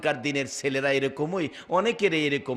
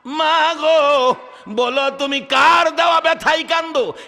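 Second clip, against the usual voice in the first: high, loud cry to mother; impassioned son's shout, acted in melodic preaching style; calling out; 9 semitones higher, 8 dB louder than usual. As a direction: call through the door with long-held vowels. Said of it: "দরজায় দাঁড়াইয়া ডাক মারছে".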